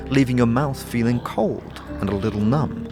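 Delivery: normal talking voice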